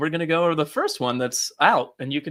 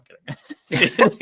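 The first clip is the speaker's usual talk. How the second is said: laughing; 4 semitones higher than usual. Real, not acted. Laughs off his own joke.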